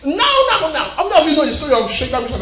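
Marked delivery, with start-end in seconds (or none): 0.0-0.9 high, insistent now now; urgent demand. Retold or imitated. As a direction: beg God for it right now.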